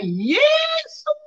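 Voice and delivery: high-pitched drawn-out cry; teriakan. Retold or imitated. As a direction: cry out the name, pitch leaping way up. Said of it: "saya teriak". (teriakan melengking memanggil Tuhan)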